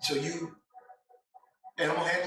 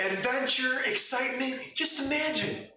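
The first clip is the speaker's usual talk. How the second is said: excited pitch; 8 semitones higher than usual. Acted. NPC sells the adventure, higher.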